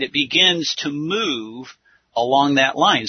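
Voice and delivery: ordinary talk, nothing marked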